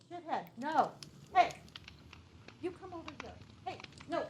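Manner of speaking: high, distant scolding; scolding a pet. Real, not acted. Streamer turns from chat to correct the dog, scolding. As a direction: call the dog off, away from the mic.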